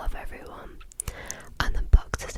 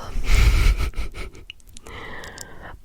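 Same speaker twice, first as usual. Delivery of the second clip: whispered giggle; 7 dB louder than usual. Real, not acted. Giggles after parenting aside.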